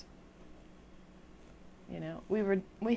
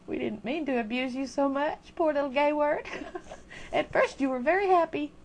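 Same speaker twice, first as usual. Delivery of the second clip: higher, louder coddling of a word; mock consoling; 8 semitones higher, 7 dB louder than usual. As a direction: pat the poor word on its head with your voice. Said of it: "patting it on its little head".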